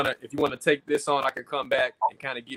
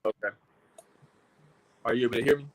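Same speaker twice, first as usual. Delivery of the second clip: crackly usb mic; 4 semitones lower, much faster than usual. Real, not acted. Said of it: "it's still crackling a little bit".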